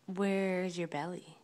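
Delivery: ordinary delivery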